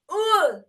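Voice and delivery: loud, high groan of disgust; disgusted ugh. Retold or imitated. Storyteller reliving the smell, disgusted groan. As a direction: recoil from a stench with an "ugh".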